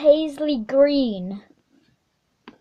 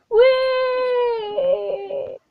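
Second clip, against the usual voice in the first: playful whee; 9 semitones higher than usual. Acted. Unicorn flying.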